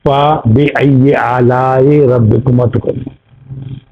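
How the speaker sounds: slow chanted recitation of the verse; Quranic recitation. Shown as a demonstration. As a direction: recite it slowly like scripture. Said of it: "आप पढ़ते हैं ना".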